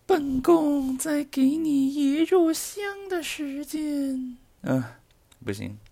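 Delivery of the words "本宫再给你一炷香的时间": very high, slow eunuch voice; haughty court eunuch, drawn out; shrill palace servant. Pretend. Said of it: "这个入戏太深了啊".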